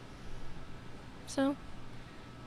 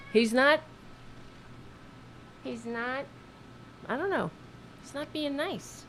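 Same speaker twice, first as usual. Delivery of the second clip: higher and much softer, cooing reproach; gentle pet scolding; 7 semitones higher, 9 dB quieter than usual. Real, not acted. Host softly scolding her cat, higher cooing tone. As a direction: chide the cat tenderly.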